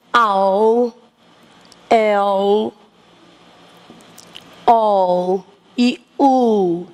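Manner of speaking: endings "ao, el, ol e u" spelt out slowly; slow list of endings. Shown as a demonstration. Teacher listing word endings for the class, slow. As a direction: read the endings out one by one, unhurried. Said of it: "como é que fica esse plural".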